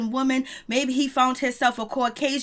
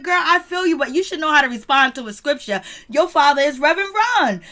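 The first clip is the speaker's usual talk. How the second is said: higher, louder teasing into a laugh; excited teasing; 3 semitones higher, 6 dB louder than usual. Retold or imitated. Teasing Angela about her father, louder laughing tease. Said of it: "I was like".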